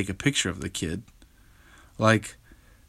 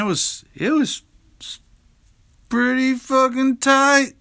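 excited; 6 dB louder than usual. Real, not acted. Dad telling listeners about his son's birthday reaction, thrilled. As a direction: let the thrill of that moment burst out.

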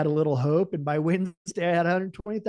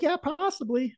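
lightly imitated receptionist, much higher voice; retold receptionist's reply; 11 semitones higher than usual. Receptionist answers boss about the gun, higher imitated voice. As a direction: pitch it up for the receptionist's quoted answer.

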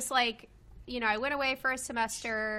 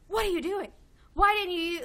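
higher, louder, imitating his angry scolding; mock-angry, raised and exasperated; retold angry outburst; 8 semitones higher, 5 dB louder, much faster than usual. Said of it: "he got so mad".

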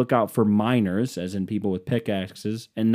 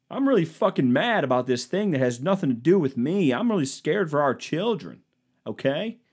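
high, indignant mocking voice; hammed-up outrage in a high voice; mock outraged conservative; 5 semitones higher than usual. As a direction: play a riled-up Facebook uncle. Same mock character ranting.

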